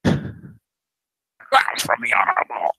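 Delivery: high breathy whisper. Real, not acted. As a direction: whisper it like a secret. Whispered aside.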